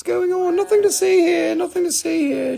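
very high, squeaky denial; mock cover-up. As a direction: deny it in a squeaky high put-on voice. Jokingly covering up the bubble.

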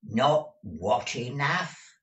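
put-on slow, puzzled old-woman question; hard-of-hearing query. A narrator's reading. Deaf Peg missing a word, slow query. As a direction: ask as if half heard.

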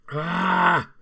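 loud high cry of pain; pained shout. Real, not acted. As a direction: yell out as the heat spikes.

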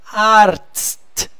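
Arzt drawn out, AR held, then a crisp TST; separated sounds. Shown as a demonstration. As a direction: say Arzt in two parts, AR and TST. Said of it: "Gente, separa".

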